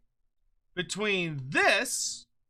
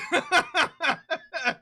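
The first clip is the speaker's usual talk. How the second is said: high, loud laugh at a ridiculous picture; real high laugh; burst of laughter; 10 semitones higher, 4 dB louder than usual. Real, not acted. Laughing at the goblin art.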